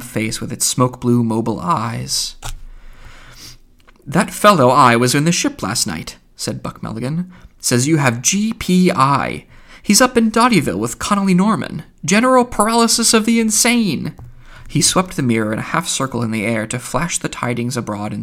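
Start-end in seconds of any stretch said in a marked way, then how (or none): 4.1-14.1 higher, louder gossiping; excited gossip, quickening; gleeful Buck Mulligan. Acted. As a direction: announce the news with relish.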